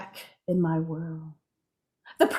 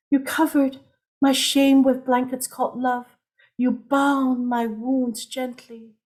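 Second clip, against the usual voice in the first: poem recital; 6 semitones higher than usual. Acted. Poet reciting to her healer, intimate spoken word. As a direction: recite it like a confession of healing.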